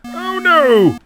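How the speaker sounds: high sudden oh no; dismayed cry. Real, not acted. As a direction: yelp as the ball drops. Ball lost.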